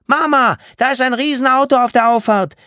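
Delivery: put-on high, loud excited child's shout; calling through the house. A narrator's reading. Martha calls to Mama about a giant car, calling through the house. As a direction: yell it up through the whole house. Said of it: "Martha rief es durch das ganze Haus".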